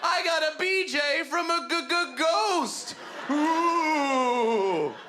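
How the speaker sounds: hammed-up wail of despair; anguished wail. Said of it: "he's like".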